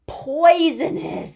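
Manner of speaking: excited slow announce. Acted. Excited discovery of big word.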